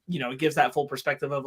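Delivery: ordinary delivery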